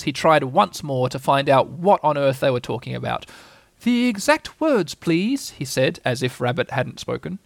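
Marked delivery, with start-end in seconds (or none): 3.8-5.5 high, slow owl voice; insistent Owl. Acted. Owl asks Rabbit once more, slow and insistent. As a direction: spell out the demand with strained patience.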